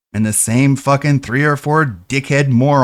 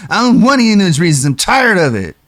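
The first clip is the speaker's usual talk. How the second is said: higher, louder, fast mocking voice; mock streamer; 7 semitones higher, 4 dB louder than usual. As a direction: dismiss every reason like a spoiled streamer. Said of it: "And he's like".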